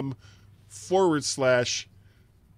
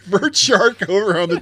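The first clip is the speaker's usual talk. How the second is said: announcer voice; 7 semitones higher, 10 dB louder, slower than usual. Pretend. Announcing his name loudly.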